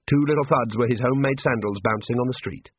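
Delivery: plain reading voice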